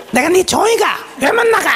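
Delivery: shrill, high mock outburst; mock furious yell. Wife mimics her own defiance toward her husband, shrill and high. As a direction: fling it back like a defiant wife, pitched very high. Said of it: "이러고".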